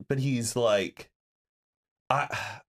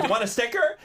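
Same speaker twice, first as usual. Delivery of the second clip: higher, laughing mock friendly; cheery offer; 10 semitones higher than usual. Imitating the cart guy offering a sticker. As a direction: offer it brightly. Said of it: "And he's like".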